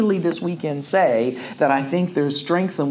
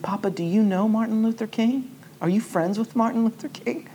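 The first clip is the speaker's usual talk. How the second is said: a lightly voiced little boy, higher; child's question; 9 semitones higher than usual. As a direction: ask innocently like a small son. Son's innocent question voiced.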